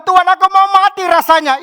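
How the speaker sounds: high, very loud outcry; acted desperate plea at full voice; anguished prayer. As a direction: cry out honestly to God in distress. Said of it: "Kalau saudara bilang".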